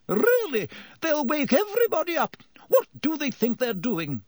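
high, fussy matron's voice; indignant nurse. A narrator's reading. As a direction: scold the noise from the corridor. Pomfrey's indignant voice.

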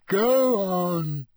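high, loud, drawn-out urging; bossy. Acted. Ghost orders Harry underwater, bossy. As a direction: push him on, relishing the power. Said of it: "enjoying bossing him around".

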